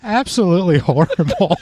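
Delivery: loud, high words through laughter; laughing. Real, not acted. Mocking the British accent attempt, laughing hard. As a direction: crack up while saying it.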